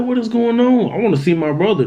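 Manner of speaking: high, urgent panicked cry; pleading to get past the curtain; desperate plea. Retold or imitated. Brothers at hospital curtain, high desperate panic.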